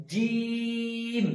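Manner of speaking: জিম very slow, the vowel stretched long and sung; drawn-out jim. Shown as a demonstration. Teacher draws out জিম three alifs long, chanted. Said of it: "জিমে তিন আলিফ টান দিতে হবে".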